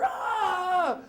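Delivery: high shout of pain from a ruler hit; pained yelp. Retold or imitated. Boy in hallway hit by ruler, yelps high=77. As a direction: yelp like you've just been struck. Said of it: "you hear".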